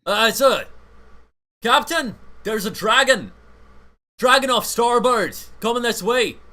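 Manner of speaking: high, loud lookout yell; shouting from crow's nest. Acted. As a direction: call out the dragon sighting. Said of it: "you guys on the ship hear, um, from the crow's nest".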